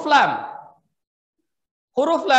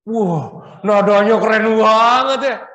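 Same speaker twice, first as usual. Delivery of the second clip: mock admiring gush; 8 dB louder than usual. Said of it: "mock admiring listener".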